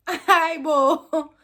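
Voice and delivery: high, loud hammed-up innocent hello; mock innocent greeting. Man on the phone faking innocence, hammed-up high and loud. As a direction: play it falsely sweet and loud.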